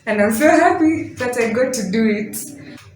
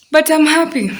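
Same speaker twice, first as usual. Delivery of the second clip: happy; 8 semitones higher, 6 dB louder than usual. Real, not acted. Joyful end of project.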